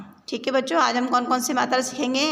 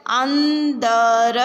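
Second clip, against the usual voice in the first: अंदर drawn out very slowly and louder; slow syllable reading; 5 dB louder, much slower than usual. Shown as a demonstration. Teacher sounds out अंदर for the class, slowly and loudly. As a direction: sound out अंदर clearly for children. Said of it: "शब्दों को पढ़ेंगे".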